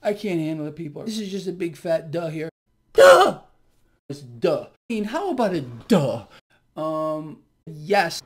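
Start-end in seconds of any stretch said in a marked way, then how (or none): 2.9-3.4 loud, high exclaimed duh; emphatic mocking burst; big fat duh. Shown as a demonstration. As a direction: blurt one huge duh. Big fat duh announced just before.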